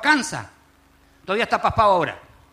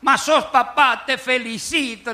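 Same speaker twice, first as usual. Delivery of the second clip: slow, higher congratulation from a priest; 5 semitones higher, slower than usual. Acted. Priest congratulating a new father, slow and emphatic. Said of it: "le decía el cura".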